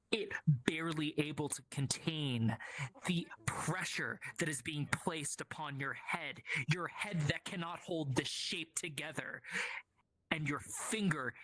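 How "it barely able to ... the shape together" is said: hushed, breathy storyteller whisper; whispered narration. Acted. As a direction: whisper the vision slowly.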